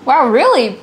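ordinary delivery

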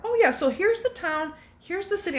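high mock sweet clerk voice; lightly put-on chirpy helper; imitating the clerk. Clerk voiced showing the map.

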